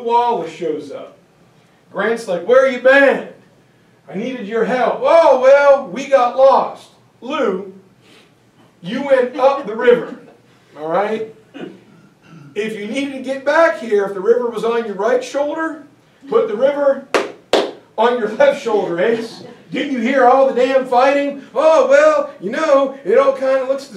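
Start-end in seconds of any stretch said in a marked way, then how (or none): 2.5-5.0 loud, higher scolding; angry Grant. Retold or imitated. Grant scolding late Wallace. 5.0-6.9 high, loud, slow excuse; sheepish Wallace. Retold or imitated. 7.2-21.4 higher, louder exasperated lecture; exasperated Grant. Retold or imitated. Grant berating Wallace.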